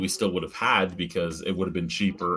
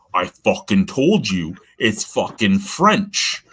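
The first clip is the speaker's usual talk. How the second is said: slow, hammered I-told-you, a bit louder; exasperated emphasis; 5 dB louder, slower than usual. Retold or imitated. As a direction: spell it out slowly, fed up. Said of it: "I'm like".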